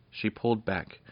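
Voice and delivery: ordinary delivery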